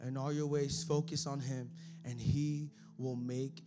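low, soft intimate sermon voice; hushed preaching. Acted. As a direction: lean in and speak quietly to them.

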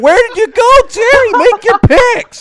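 high, loud calling out. Real, not acted. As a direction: call out to someone far away. Calling to silent caller.

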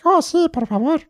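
very high-pitched mimicked Spanish; imitated older lady. Older friend accepting offered fries, high and eager. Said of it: "And she's like".

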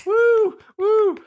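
high cheering whoop. Pretend. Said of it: "being announced the winner".

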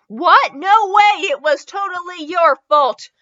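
loud, high-pitched objection; indignant protest. Acted. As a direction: throw the blame back loudly. Acted argument after a collision.

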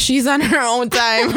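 laughing through the words. Real, not acted. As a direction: say it while giggling. Laughing at friend.

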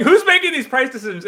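mock yell of a colleague's complaint; Jeff Mans yelling. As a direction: act out someone shouting in protest. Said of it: "Jeff Mans was yelling".

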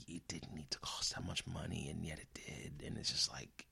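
bitter whisper of disbelief; hushed, seething under the breath. Real, not acted. Devastated rant drops to whisper.

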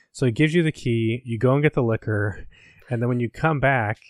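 normal talking voice

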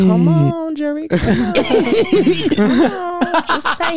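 high, wheedling plea; hammed-up cajoling; mock pleading. Defendant begging jury, acted.

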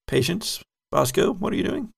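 normal talking voice

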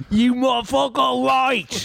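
high, exaggerated Cockney outburst; Michael Caine. Co-host continuing the Michael Caine bit, mock British accent. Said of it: "They sound like fucking Michael Caine".

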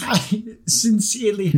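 put-on lightly grand old English lady; understated Downton dowager; Maggie Smith voice. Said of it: "I went into Maggie Smith".

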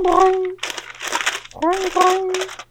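a cartoon boing in a very high voice; boing noise. Shown as a demonstration. Imitating a ringing call.

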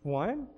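"One" as a question, rising at the end; questioning echo. Shown as a demonstration. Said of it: "what's an accent?".

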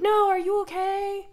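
high, sweet worried voice; exaggerated caring turn; sudden mock concern. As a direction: flip to fussing over him.

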